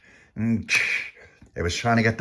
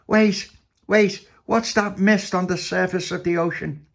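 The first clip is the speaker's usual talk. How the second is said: alarmed captain; 10 semitones higher than usual. A narrator's reading. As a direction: call out high on spotting danger. Captain spots mist.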